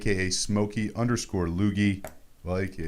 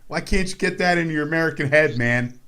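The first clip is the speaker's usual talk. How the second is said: louder, higher, hammed-up offended rant; mock indignation; 6 semitones higher, 4 dB louder than usual. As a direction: play at being insulted on behalf of Canada. Mock offended Canadian defends Thanksgiving.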